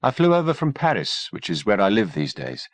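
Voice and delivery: a hint of a cool woman doctor. A narrator's reading. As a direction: lightly voice the poised doctor. Kimberly voiced, calm doctor.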